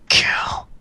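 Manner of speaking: hissed ghostly whisper; raspy whisper. Acted. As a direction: breathe the word out, unvoiced. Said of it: "a raspy, furious voice say".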